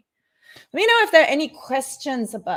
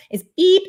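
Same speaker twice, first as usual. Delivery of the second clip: mock beep; 9 semitones higher, 8 dB louder than usual. Imitating a beep sound.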